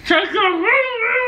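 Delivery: frustrated shout. Real, not acted. Frustrated repeat, mouthpiece.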